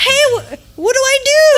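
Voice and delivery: a lost guy asking for help in a rush; panicked date. Retold or imitated. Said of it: "He goes".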